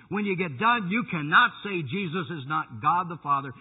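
high, loud, driving proclamation; full-force preaching. Real, not acted. Preacher proclaiming Christ's deity to his congregation, full-force preaching. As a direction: build to ringing, weighted phrases.

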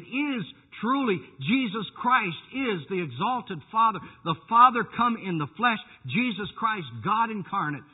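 high, loud, driving proclamation; full-force preaching. Real, not acted. Preacher proclaiming Christ's deity to his congregation, full-force preaching. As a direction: build to ringing, weighted phrases.

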